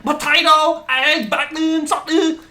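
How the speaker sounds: high, loud, slow irritated list; impatient Vietnamese-accented lady. Retold or imitated. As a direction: spell it out like she's fed up. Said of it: "She's like".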